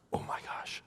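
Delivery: whispered, appalled aside; hushed dismay. Retold or imitated. Onlooker to himself about rude passengers, whispered dismay. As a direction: say it under your breath, aghast.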